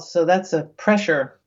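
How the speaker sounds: ordinary delivery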